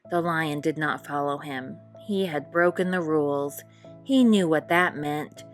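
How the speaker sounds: ordinary delivery, nothing marked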